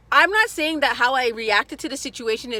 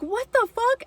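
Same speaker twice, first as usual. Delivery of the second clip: shocked outburst; 13 semitones higher, slower than usual. Retold or imitated. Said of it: "I was like".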